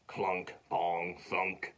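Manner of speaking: low, soft, separated knocks; clunking sound imitation. Wood pieces hit the basket, low dull clunks. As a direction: drop each word like wood landing.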